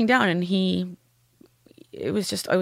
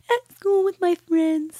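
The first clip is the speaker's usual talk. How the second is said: put-on much higher, slow child voice; imitating her daughter; 10 semitones higher, slower than usual. Daughter telling her mother her happy place, high child voice. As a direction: answer brightly as the little girl. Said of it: "And she said".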